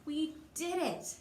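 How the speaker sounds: a touch of victory cheer; triumphant. Acted. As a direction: celebrate reaching the top. Reaching the top together.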